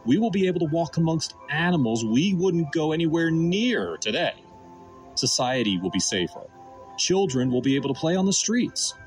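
ordinary delivery

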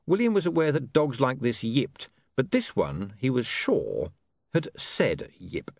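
plain reading voice

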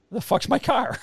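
sudden high startled cry; shocked outburst. Retold or imitated. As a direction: blurt it out in disbelief. Sister finds car gone.

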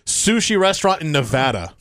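ordinary talk, nothing marked

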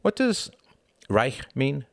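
put-on high, curious boy's question; a small boy piping up; young boy. A narrator's reading. Young Tom asks a question.

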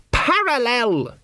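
sharp, slow, high-pitched correction; a fussy young wizard snapping back; snapped. A narrator's reading. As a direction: bite off the word in irritation. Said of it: "snapped Ponder".

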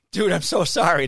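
mock-pleading sorry; apologizing loudly to the kid kicked out of the cage; loud apology.

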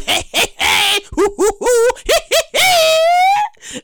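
rising hey hoo hee chant, high at the top; party hype chant. Retold or imitated.

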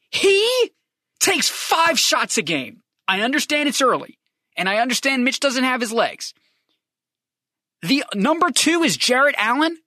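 high-pitched, exasperated sports rant; emotional rant. Real, not acted. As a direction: plead in disbelief, stretching the key words. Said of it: "I'm sorry I got emotional".

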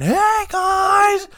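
very high, loud drawn-out yell; mimicked greeting shout. Friend mimicking the retold park greeting, high shout. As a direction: parody the greeting as a piercing shout. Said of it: "just when you went".

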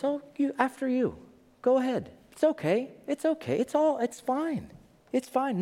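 high, overly polite reassurance; mock-cheery crew brushing off the danger; calm polite crew. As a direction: usher people along sweetly. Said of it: "The crew played it down".

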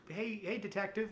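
a hint of a ghostly child calling out; dead little boy. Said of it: "the dead little boy from the opening scene comes up and goes".

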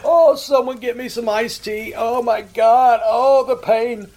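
loud, high, slow fake-wounded wail; mock agony. Prankster mocking a victim's insult, hammed-up agony. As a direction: ham up being mortally hit by a feeble insult.

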